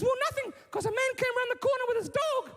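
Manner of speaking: put-on very high little-girl voice; upset daughter. Daughter telling dad about a man, high upset voice. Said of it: "And then she says".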